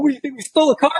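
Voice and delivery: high-pitched mock indignation; hammed-up, high defensive comeback; indignant retort. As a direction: fire back in a high, incredulous voice. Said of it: "I'm like".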